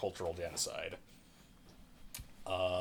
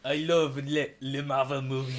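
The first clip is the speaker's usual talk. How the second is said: louder, higher put-on French accent, gushing; mock French accent; 5 semitones higher, 6 dB louder than usual. Film fan mocking a French actress, in a hammed-up French accent. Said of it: "that's not even the same accent".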